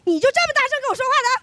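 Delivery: fast, high mock scold, laughing; mom's scolding echoed back. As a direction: fire her line back at her. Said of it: "我给她讲道理".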